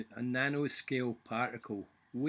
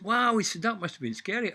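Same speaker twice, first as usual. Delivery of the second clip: higher, louder wide-eyed lad; amazed apprentice; 7 semitones higher, 7 dB louder than usual. Retold or imitated. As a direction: gasp it like an impressed young apprentice. Apprentice voiced in joke.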